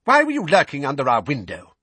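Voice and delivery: quick, high, loud accusation; sharp scolding aunt voice; livid aunt. A narrator's reading. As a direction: demand an answer, high and fast. Livid aunt confronts Harry.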